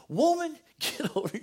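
put-on high, loud little boy calling out with a laugh; boy imitating grandpa, calling a girl over; grandson's bossy call. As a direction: play a small boy bossing a girl around, laughing. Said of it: "he looked up and he says".

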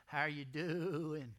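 slow, drawn-out friendly greeting in a put-on voice; shepherd greeting his sheep. Preacher voicing a shepherd greeting his sheep, slow mock greeting. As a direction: stretch it out like a lonely shepherd chatting to his flock. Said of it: "and they say".

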